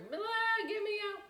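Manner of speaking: high, mocking escape cry; playful high-pitched groan; mock whine. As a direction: squeal it like a kid dying to leave. Mocking kids leaving school.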